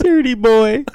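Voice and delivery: high, loud scolding mom; hammed-up high-pitched telling-off; Martha. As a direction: scold the son in a mock mother voice. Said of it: "That's our Martha and Lou impression".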